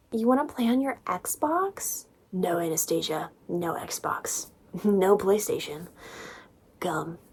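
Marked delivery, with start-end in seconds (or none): 0.1-2.1 mock naive question. Mocking naive Anastasia. 2.3-4.5 mock stern murmur.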